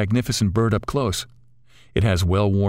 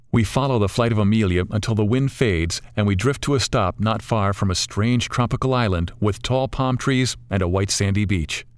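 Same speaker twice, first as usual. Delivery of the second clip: ordinary delivery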